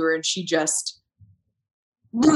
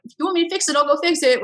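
higher, accommodating; a light, eager-to-help tone; helpful offer; 8 semitones higher, faster than usual. Pretend. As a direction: offer to fix it pleasantly. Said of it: "what I should have said".

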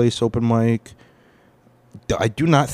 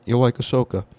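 usual speaking voice

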